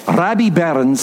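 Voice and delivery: Scottish pronunciation of the poet's name; Scottish accent. Retold or imitated. Preacher introducing a Burns poem to the congregation, Scottish accent. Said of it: "or as he would say".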